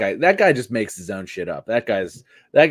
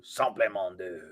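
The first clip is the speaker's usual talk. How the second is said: soft, lightly voiced catchphrase; half-hearted catchphrase; quiet gimmick catchphrase; 3 semitones lower, 10 dB quieter, slower than usual. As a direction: throw away the gimmick under the breath. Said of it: "this was your gimmick".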